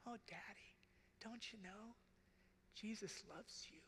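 put-on high, very soft little-girl voice; tender child voice. Daughter comforts her crying father, high and very soft. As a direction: coo it like a small daughter comforting. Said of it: "She goes".

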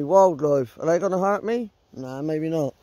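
normal talking voice

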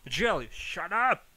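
loud, sharp telling-off; irritated loud outburst; exasperated shout. Real, not acted. Snapping at someone after scare.

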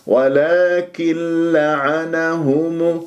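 ولكن لعنهم very slow, nun merged into a short doubled lam. Shown as a demonstration. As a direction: read ولكن لعنهم slowly without stretching the lam. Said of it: "بهذه الطريقة".

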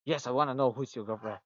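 normal talking voice

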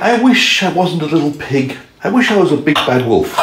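high, piggy little voice. A narrator's reading. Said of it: "and he said".

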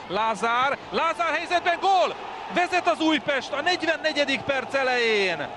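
rising, shouted goal call; excited goal call. Real, not acted. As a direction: build up and roar the goal. Goal call, live commentary. (izgatott gólkiáltás)

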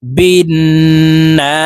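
"বিন" with a long nasal n, then "না" stretched; ghunnah and madd. Shown as a demonstration. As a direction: hum the n of "বিন", lengthen the vowel of "না". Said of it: "এক আলিফ টেনে পড়তে হবে". ("বিন"-এ গুন্নাহ, "না" এক আলিফ টান)

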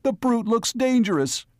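high-pitched, panicked warning. A narrator's reading. Mayor urging gunmen against the creature, high with panic. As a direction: warn them in a panicky high voice. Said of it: "his voice high with panic".

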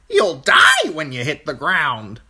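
high, loud, drawn-out bird voice; blunt crow. A narrator's reading. Crow warning Bran about the ground, blunt and high. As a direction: hit DIE hard as a crow.

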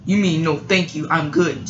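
usual speaking voice

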